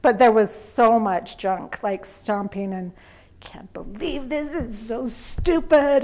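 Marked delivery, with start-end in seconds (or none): 3.4-6.0 put-on whispered, slow teen mutter; mock grumbling teen. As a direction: mimic a sulky sixteen-year-old, hushed. Son grumbling, stomping.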